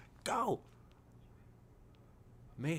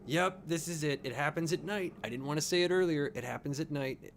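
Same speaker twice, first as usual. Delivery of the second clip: nervous excitement; 10 semitones higher, 9 dB louder than usual. Real, not acted. Tense scene about to hit.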